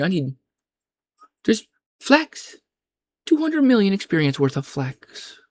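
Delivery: high-pitched, hammed-up pitch to a buyer; exaggerated, incredulous offer; mock exclamation. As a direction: call it out high, as if showing off a free haul. Acted exchange over free flax.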